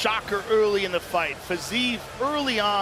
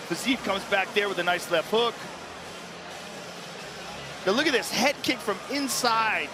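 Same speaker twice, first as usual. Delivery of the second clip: usual speaking voice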